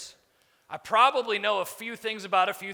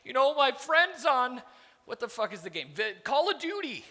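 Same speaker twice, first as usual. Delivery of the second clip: mock whiny excuses; 5 semitones higher, 5 dB quieter than usual. Speaker mocking staff who skip work for a video game, higher whine. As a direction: mimic a lazy employee's excuses.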